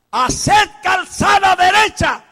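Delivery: slow, loud proclaiming voice; prophetic shout. Acted. John as the voice in the desert, crying out. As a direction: cry it out like a voice in the desert. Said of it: "prophetic proclamation".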